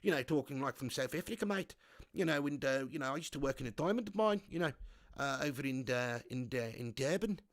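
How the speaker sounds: a mock South African accent, softly spoken; playful imitation of a South African. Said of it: "kind of a little bit like this accent".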